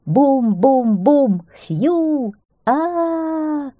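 slow high drum booms, whistle and long cry; voiced drumbeats, whistle toot and sustained wail; imitated forest din. A narrator's reading. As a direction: act out drum, whistle and scream in turn. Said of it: "неслось по лесу".

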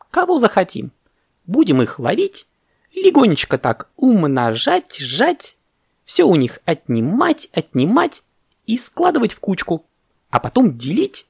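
high, swooping monkey voice; eager monkey. Acted. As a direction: relish the plan, lifting each phrase. Monkey happily plans mischief.

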